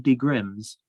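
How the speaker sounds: a quick, light attempt at a German accent on De Grimm's. Shown as a demonstration. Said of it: "That was an attempt at a German accent".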